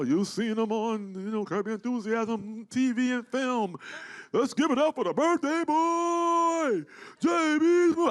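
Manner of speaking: slow, high announcer build to a shout; building to a loud shouted introduction; Michael Buffer intro. Retold or imitated. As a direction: ring announcer hyping the birthday boy. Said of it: "plays the Michael Buffer".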